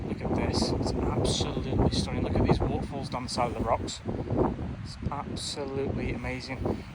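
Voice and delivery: soft, awed, nearly whispered; hushed awe. Real, not acted. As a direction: breathe it out in wonder.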